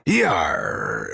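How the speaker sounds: very low, growled pirate yar. Acted. Practicing piracy.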